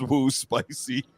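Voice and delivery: slow, drawn-out mock "woo"; a playful slow whoop; imitating Henry. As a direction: stretch it out teasingly. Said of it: "Henry goes".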